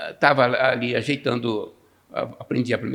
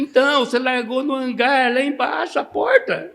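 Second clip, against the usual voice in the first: shouting over noise; 11 semitones higher, 5 dB louder than usual. Retold or imitated. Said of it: "Eu falei assim".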